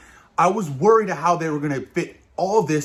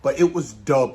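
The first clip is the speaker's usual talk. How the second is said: ordinary delivery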